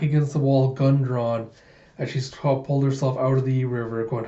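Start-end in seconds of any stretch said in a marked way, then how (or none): none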